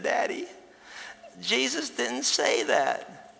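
put-on high, slow little-girl voice, earnest; a small child correcting her dad. Girl tells her dad on the plane, high and slow.

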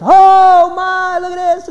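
high, loud gasp of amazement; a crowd marvelling out loud; amazed crowd. Acted. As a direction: gush like a dazzled onlooker. Crowd amazed at cat waiter.

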